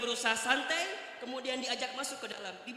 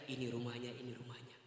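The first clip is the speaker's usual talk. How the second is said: hushed murmur; 9 semitones lower, 9 dB quieter than usual. Retold or imitated. Husband whispering, unsure.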